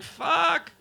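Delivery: a sudden yelp of frustration, pitched sharply high; frustrated curse. Retold or imitated.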